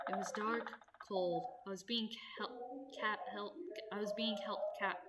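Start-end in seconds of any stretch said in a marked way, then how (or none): none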